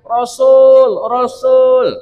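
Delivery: imitating an insistent caller; imitated calling out. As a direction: call out like someone yelling for attention. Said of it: "ada orang teriak-teriak".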